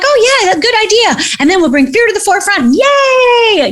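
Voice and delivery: high, excited mock cheer; giddy agreement. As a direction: cheer it like a naive angel. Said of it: "We're like".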